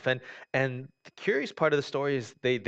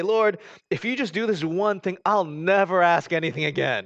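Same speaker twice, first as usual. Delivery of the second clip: high, loud eager pleading; imitated desperate bargain with God; bargaining prayer; 5 semitones higher, 6 dB louder than usual. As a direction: promise God anything, loudly.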